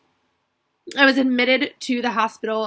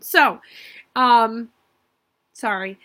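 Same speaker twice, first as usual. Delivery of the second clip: choking up; 5 dB louder, slower than usual. Real, not acted. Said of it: "I knew I'd get emotional".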